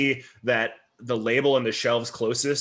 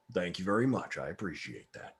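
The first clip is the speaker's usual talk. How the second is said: Lot's polite thanks; 5 semitones lower, 9 dB quieter than usual. Acted. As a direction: lower and much softer, fading politeness. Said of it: "Lot goes".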